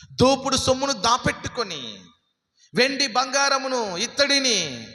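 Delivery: high, sustained sermon pitch; rapid-fire preacher on a high held tone; full-force preaching. Acted. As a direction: proclaim it urgently to the congregation.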